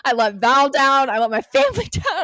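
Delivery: loud, rising mock lament with a laugh; retold self-reproach. Recalling the broken promise, in loud hammed-up self-blame. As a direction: cry out each failure, half laughing.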